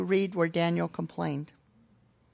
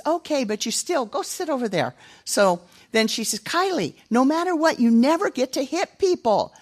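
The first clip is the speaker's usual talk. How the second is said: put-on niece correcting kids; 6 semitones higher, 4 dB louder than usual. Niece settles both kids.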